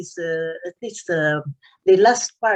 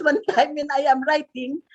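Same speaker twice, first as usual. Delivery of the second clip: normal talking voice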